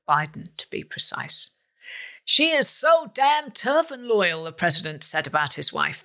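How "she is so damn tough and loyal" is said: high, slow mock American accent; bad American accent. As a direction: quote it slowly in a hammed-up American accent. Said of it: "That's my worst American accent".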